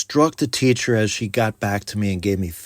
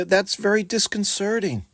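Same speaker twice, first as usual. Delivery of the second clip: mildly concerned; 8 semitones higher than usual. Shown as a demonstration. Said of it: "you can say".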